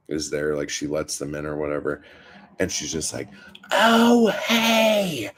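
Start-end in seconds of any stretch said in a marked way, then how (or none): 3.7-5.3 high, drawn-out holler; mock scream across the neighborhood; screaming greeting. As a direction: imitate the loud neighbor screeching hello. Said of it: "just screaming all the fucking time".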